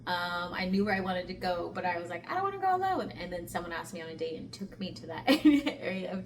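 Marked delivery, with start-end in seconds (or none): 2.3-3.1 high-pitched reluctant self-quote; mock reluctant. Telling viewers why she held back, in a high mock-reluctant voice.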